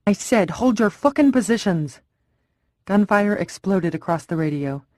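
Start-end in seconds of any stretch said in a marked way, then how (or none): 0.1-2.0 louder, higher enraged order; furious shout. Acted. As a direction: bark it in fury. Commander furious over radio.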